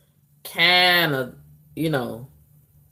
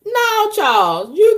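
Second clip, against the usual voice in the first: scolding Charles; 5 dB louder, slower than usual. Acted.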